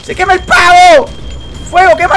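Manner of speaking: high, loud alarmed yelling; shouting that the turkey is on fire; panicked shouting. Real, not acted. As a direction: yell in panic as the turkey burns. Turkey catches fire in game.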